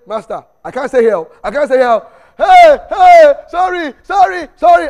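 frantic, rising yells of fear; panicked shout. Retold or imitated. Gehazi panics and flees.

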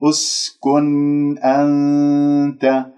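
very slow, sustained recitation; demonstrated tajweed recitation. Shown as a demonstration. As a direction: stretch each long vowel and hum the noon. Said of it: "لاحظتم كيف؟". (ترتيل تعليمي بطيء)

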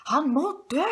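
high, loud villain's cry; furious shriek. A narrator's reading. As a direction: shriek it out as a death sentence. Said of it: "skrek Bean". (høyt, rasende skrik)

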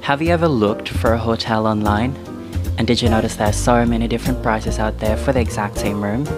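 ordinary talk, nothing marked

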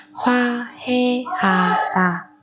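very slow, spaced Hoa heata; syllable by syllable. Shown as a demonstration. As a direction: pause after each syllable of Hoa heata. Teacher splits word by syllable. (đọc thật chậm Hoa heata từng âm tiết)

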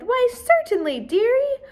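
put-on high, sweet old-lady voice. A narrator's reading. Old neighbour agreeing sweetly to the girl, high. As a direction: coo it like a kindly grandma. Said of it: "Missus Jacoby said".

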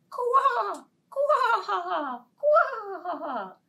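very high loud frog croaks. Acted. Frog croaking from the mushroom, loud.

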